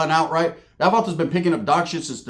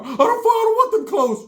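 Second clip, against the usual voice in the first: squeaky high cartoon-mouse cousin; Mickey Mouse voice. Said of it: "put the Mickey Mouse to it".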